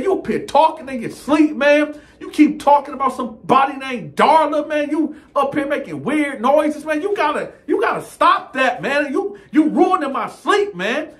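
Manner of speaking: high, strained scolding; fed-up cellmate berating his bunkie, high pitched. Retold or imitated. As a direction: voice pushed up high in frustration. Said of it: "He done took me to my higher voice".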